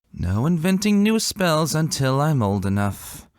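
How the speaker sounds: put-on slow, sour child voice reciting a rule; a touch of grudging sourness; sour recital. A narrator's reading. Daughter repeating a rule she dislikes, mildly sour and slow. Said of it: "her voice like lemons".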